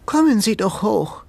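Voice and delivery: lightly voiced old lady, cool and polite; a hint of a stately old woman smiling coolly; cool smile. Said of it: "mit einem kühlen Lächeln".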